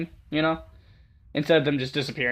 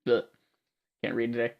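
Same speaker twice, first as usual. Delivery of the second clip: ordinary delivery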